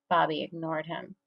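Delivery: usual speaking voice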